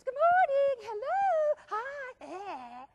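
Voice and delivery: high, loud, gushing chirpy greeting; overly cheerful office colleague chirping hello; Sally Sunshine. Retold or imitated. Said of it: "the Sally Sunshine in the office".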